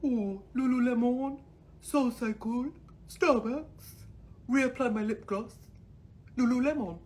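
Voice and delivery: high, slow airhead voice with rising lists; ditzy rich girl reciting her day; mocking Miami women. Vlogger sneering at Miami yoga women, in a high ditzy voice. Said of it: "They're just like".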